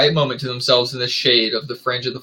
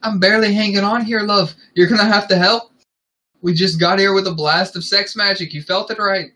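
raised-pitch Scottish character voice; Scottish accent; 7 semitones higher, 3 dB louder than usual. A narrator's reading. He tells his love to help, in a Scottish accent. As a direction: play him Scottish, pitched well up. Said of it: "Stop with the Scottish accent".

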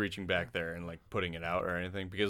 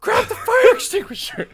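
very high, loud mock scream; hammed-up emergency shout; retold panicked yell; 13 dB louder than usual. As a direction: replay a frantic squeal, breaking into laughter. Retold yelled order during fire.

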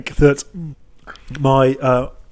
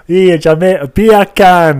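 loud, higher mock Jamaican; Jamaican accent; 5 semitones higher, 8 dB louder, much slower than usual. Retelling the joke tried at home, loud Jamaican accent. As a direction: belt it out in a put-on Jamaican accent.

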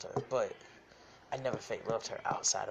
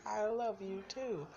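high drawn-out mock reply; high, slow mock girlfriend; 11 semitones higher, slower than usual. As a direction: lift high and stretch it out. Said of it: "she was like".

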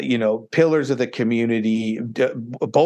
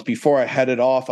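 ordinary talk, nothing marked

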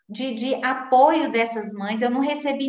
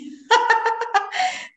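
embarrassed laugh; 11 semitones higher than usual. Real, not acted. Laughing at herself.

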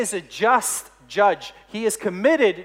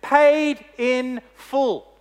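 Paid in full, drawn out very slowly and raised; slow, weighty pronouncement of freedom; judge's solemn verdict; 3 semitones higher, 4 dB louder, much slower than usual. Acted. Judge tells the freed criminal, slow and solemn. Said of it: "And he says to him".

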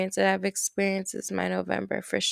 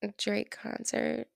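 whispering; 8 dB quieter than usual. Real, not acted. Whispered answer.

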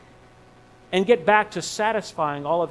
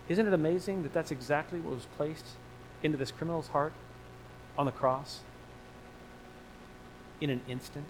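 much softer, drawn-in awe; hushed wonder; 4 semitones lower, 12 dB quieter than usual. Real, not acted. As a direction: let it fall to a near hush in amazement. Hushed wonder at criminal's heart.

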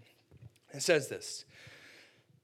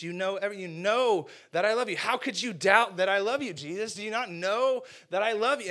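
louder, higher pleading exasperation; exasperated Peter; 6 semitones higher, 6 dB louder than usual. Acted. Peter pressing Jesus about his love, mock exasperated. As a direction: push back hard, hurt and annoyed. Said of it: "Peter's kinda ticked off".

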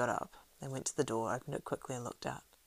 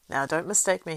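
louder urgent cry; cried out; 2 semitones higher, 10 dB louder than usual. A narrator's reading. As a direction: call out to stop them. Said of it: "he cried".

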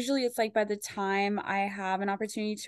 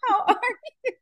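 much higher, sugary phone voice through a laugh; sister's phone voice. Retold or imitated. Teasing the sister's boyfriend phone voice, high and sugary while laughing. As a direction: mimic the sweet voice she used with her boyfriend, pitched way up. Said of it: "her voice would totally change".

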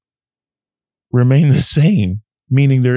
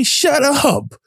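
high, sharp shut up. Retold or imitated. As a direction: cut the inner voice off, pitched way up. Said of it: "And I had to say".

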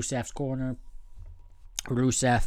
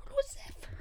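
whispered name; 10 dB quieter than usual. Retold or imitated.

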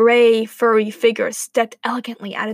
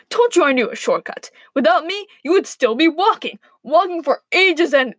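higher, louder, lively boasting; excited; 5 semitones higher, 4 dB louder than usual. A narrator's reading. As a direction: crow about it eagerly. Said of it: "asked the wolf excitedly".

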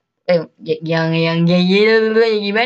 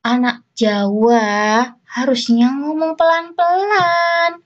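slow, higher, drawn-out scolding mum; nagging mum; 6 semitones higher, slower than usual. Retold or imitated. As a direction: lecture her like a fussy Javanese mother. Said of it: "emakku tuh selalu ngomel".

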